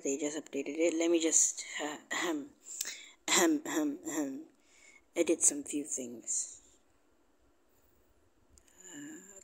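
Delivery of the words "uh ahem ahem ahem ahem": clearing throat. Real, not acted. Clears throat before recording.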